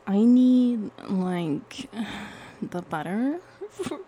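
mock Valley Girl with a loud, stretched start. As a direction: ham up a Valley Girl, giggling through it. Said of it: "should I do Valley Girl?".

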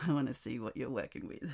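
normal talking voice

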